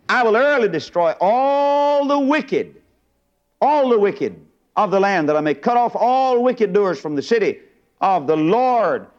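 high, drawn-out pulpit declamation; forceful scripture preaching. Acted. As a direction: stretch the key words out high for the congregation.

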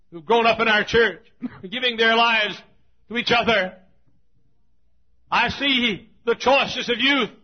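fervent revival sermon cry; preaching at full force. Acted. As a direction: preach it to the rafters.

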